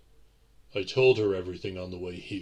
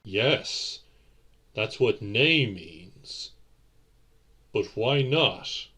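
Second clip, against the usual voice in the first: slow pleading; 2 semitones higher, 4 dB louder, much slower than usual. Acted. Nephew pleading with his aunt for the turning, slow. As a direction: draw it out, begging.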